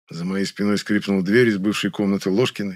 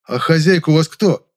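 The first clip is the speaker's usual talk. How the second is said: louder, higher, impatient question; exasperated; 7 semitones higher, 7 dB louder than usual. A narrator's reading. Narrator tires of Nyura's muddle, exasperated. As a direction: press her with growing irritation. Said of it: "бестолковость начала надоедать".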